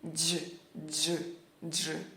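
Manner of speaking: quiet isolated "dj" sound; soft repeated "dj". Shown as a demonstration. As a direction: repeat "dj" softly. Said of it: "vai ter esse som".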